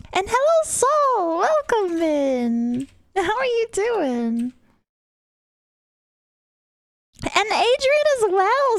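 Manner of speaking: bright high-pitched hello to arriving viewers; excited high greeting. Real, not acted. Streamer calling hello to viewers arriving, high and excited.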